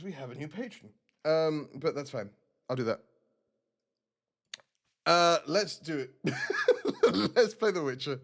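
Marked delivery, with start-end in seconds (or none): 6.2-8.2 giggly announcement; real giggles breaking up the words; laughing. Real, not acted. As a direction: laugh your way into the line. Giggles at botched introduction.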